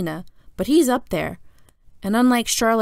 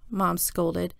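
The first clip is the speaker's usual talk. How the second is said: plain reading voice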